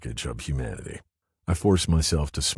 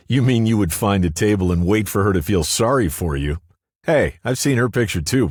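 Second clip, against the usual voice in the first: raised, loud teasing jibe; loud jeering; 7 semitones higher, 6 dB louder than usual. A narrator's reading. Larry mocks the narrator waiting for her sympathy, loud jeering. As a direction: jeer with higher, louder voice.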